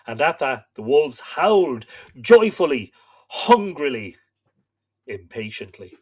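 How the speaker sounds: slow, solemn storytelling read; story narration. A narrator's reading. Host reading myth to live viewers, solemn narration. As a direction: read the old myth aloud, grave and steady. Reads Irish myth aloud.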